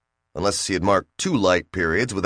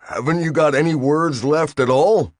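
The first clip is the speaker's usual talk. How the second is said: high, louder pleading question; 7 semitones higher, 3 dB louder than usual. A narrator's reading. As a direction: beg for one more word. Bill pleading to silence.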